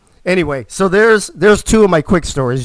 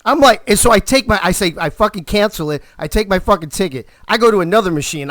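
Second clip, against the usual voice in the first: ordinary talk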